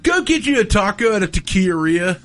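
ordinary talk

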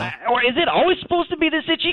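casual Australian talking dog; chatty Aussie caller, thick Australian accent. Acted.

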